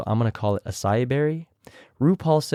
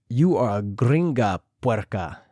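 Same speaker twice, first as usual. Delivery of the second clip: put-on Spanish-accented read. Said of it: "I tried my best to imitate them".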